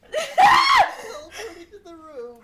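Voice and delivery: shouting through laughter; laughing hysterically. Real, not acted. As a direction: narrate while cracking up.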